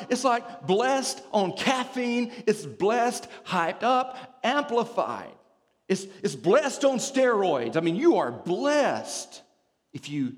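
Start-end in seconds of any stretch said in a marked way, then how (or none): 0.1-7.8 high, excited preacher build; hyped-up emphasis. Real, not acted. Preacher amplifying what blessed means, hyped-up emphasis. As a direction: hype each phrase up, pitched high.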